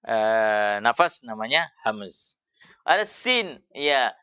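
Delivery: normal talking voice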